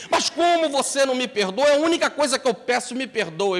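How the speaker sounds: loud, high begging shout; desperate plea. Acted. The thief begs Gandhi to forgive him, shouting high. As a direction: cry out for forgiveness.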